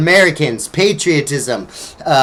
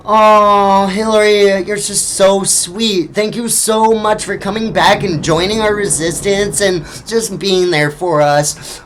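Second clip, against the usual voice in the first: mock-sweet, higher and slowed; sarcastic sweetness; 3 semitones higher than usual. Real, not acted. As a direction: gush falsely as if truly moved. Sarcastic praise of Hillary.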